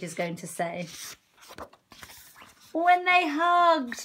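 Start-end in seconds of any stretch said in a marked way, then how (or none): 2.7-4.0 slow, high and loud with delight; joyful reveal. A narrator's reading. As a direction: announce it like the happiest moment. Big reveal of the hug.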